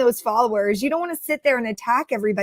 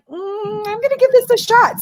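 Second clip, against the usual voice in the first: intrigued high voice; 10 semitones higher than usual. Retold or imitated. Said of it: "sometimes I'm like".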